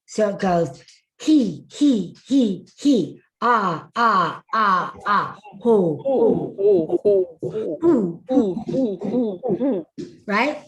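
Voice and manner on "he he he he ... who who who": short pushed he, ah, who on a four count; breath exercise syllables. Shown as a demonstration. Voice coach leading breath drills, punchy syllables. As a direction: pump each syllable from the diaphragm. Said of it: "exercises like this on a four count".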